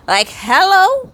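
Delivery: high, loud rising "hello?"; loud, sassy exclamation; emphatic hello. Real, not acted. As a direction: pitch it way up, as if stating the obvious. Cheering the character's clever retort.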